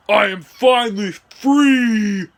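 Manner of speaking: loud, slow, high villain cry; exultant villain bellow; triumphant villain. Acted. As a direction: proclaim freedom loud and drawn out. Villain breaks free of mind control.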